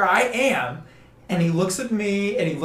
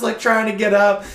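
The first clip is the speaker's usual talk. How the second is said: ordinary talk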